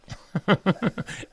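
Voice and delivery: a burst of high laughter. Real, not acted. Laughing at own joke.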